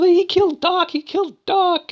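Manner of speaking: very high mock-hysterical wail; hammed-up tearful accusation; delusional, crying woman. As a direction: imitate a delirious woman in falsetto. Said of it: "She's delusional".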